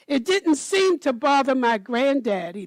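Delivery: ordinary delivery, nothing marked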